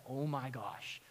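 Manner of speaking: low, very soft amazement; acted under-the-breath astonishment; hushed awe. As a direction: murmur it low, awed by what you see. Watching imagined lion's teeth, awestruck.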